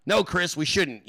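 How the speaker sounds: normal talking voice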